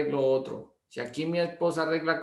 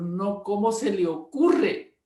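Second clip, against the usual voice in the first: embarrassed protest; 6 semitones higher, slower than usual. Retold or imitated. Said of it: "ella muy apenada".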